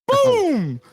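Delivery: loud, high, drawn-out "boom"; shouted gunshot boom. Retold or imitated. Friend telling how the rifle went off, shouted boom. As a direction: burst out a booming gunshot. Imitates the gun going off.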